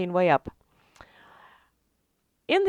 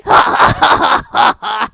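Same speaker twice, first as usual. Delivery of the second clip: hearty laugh; 10 dB louder than usual. Acted. Beholder laughs at own joke.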